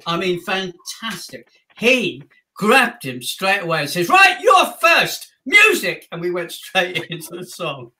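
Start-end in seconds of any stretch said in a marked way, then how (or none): 4.1-6.1 high, loud stage call; brisk covering shout. Retold or imitated. Partner grabs kid and cues band, loud showbiz shout. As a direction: call it out to rescue the show.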